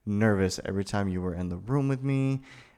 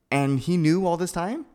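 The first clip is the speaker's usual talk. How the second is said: stunned disbelief; 7 semitones higher, 5 dB louder than usual. Acted.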